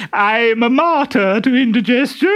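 very high, loud simpering falsetto; disguised Harlequin. Acted. Harlequin in a dress flirting with the captain, high simpering falsetto. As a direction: gush like an ugly coy bride.

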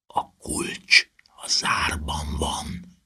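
slow, hushed whisper; secretive drawn-out whisper. A narrator's reading. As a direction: whisper it urgently, drawing each word out. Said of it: "suttogta Harry".

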